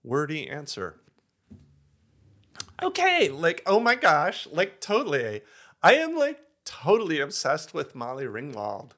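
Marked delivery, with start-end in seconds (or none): 2.8-8.9 put-on Valley Girl voice. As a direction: gush like an eighties Valley Girl. Valley Girl answer begins.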